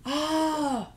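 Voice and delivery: normal talking voice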